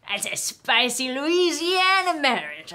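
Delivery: slow, hammed-up Southern drawl from Louisiana; James Carville impression. Podcaster quipping about a couple's makeup sex, slow Louisiana drawl. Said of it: "I had a James Carville impression".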